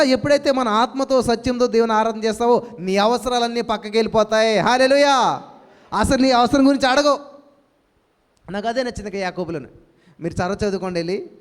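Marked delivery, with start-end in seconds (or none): 4.6-7.2 full-force preaching. Acted. Pastor rousing his congregation with louder, higher preaching. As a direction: preach it out to the church with rising fervour.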